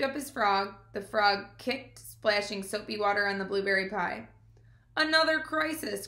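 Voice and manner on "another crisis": groaning. Acted. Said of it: "groaned Fern".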